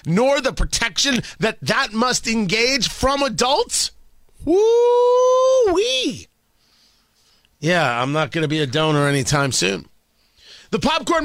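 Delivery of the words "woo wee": high, loud astonished whoop; woo-wee whoop. Real, not acted. Radio host whoops at the ACLU's madness, high and loud. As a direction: let out a high stunned whoop. Host reacts to outrageous story.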